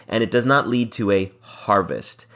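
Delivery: ordinary talk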